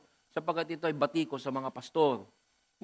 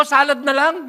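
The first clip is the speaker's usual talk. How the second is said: mock order; 9 semitones higher, 11 dB louder than usual. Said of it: "Sabi ko".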